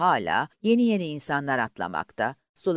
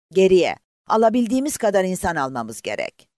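loud, raised command to turn back; shouted order; 6 semitones higher, 7 dB louder than usual. Acted. Officer ordering the boat back for survivors, loud command. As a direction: call the order firmly over the sea.

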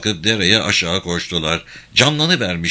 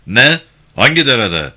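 startled outburst; 3 semitones higher, 7 dB louder than usual. Acted. Captain hears of abandoned wounded.

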